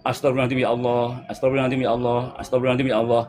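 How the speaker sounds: low, even repeated dhikr; dhikr recitation. Shown as a demonstration. Teacher shows followers how to repeat the remembrance, low dhikr recitation. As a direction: recite it low and steady, like a litany.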